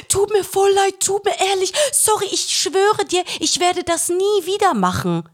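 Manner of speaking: high, pleading sorry, mock-pitiful; whiny apology. Groper begging the woman he pinched, high mock whine. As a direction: beg forgiveness like a caught little boy. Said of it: "Und er so".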